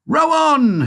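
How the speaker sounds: very high, loud command to the rowers; shouted. A narrator's reading. Wonka shouts at the Oompa Loompas, high loud command. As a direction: bark the order over the river. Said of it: "shouted Mister Wonka".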